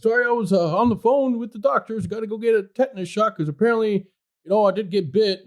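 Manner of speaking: a mild imitation of the apologetic old man; old neighbor voice. Voicing the bitten old neighbor.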